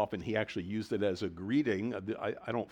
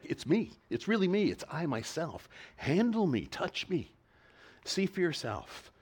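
high, insistent "It's me", stressing "me" and "I myself"; emphatic; 6 semitones higher than usual. Acted. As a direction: press "It's I myself" in a raised pitch. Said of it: "It's emphatic".

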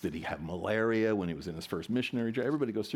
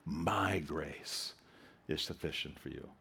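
"My" drawn out, then a pause and a softer, lower finish; stress on MY; 5 semitones lower, 4 dB quieter than usual. Shown as a demonstration. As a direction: lean on "My", then let the rest drop away. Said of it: "one word at a time and emphasize it".

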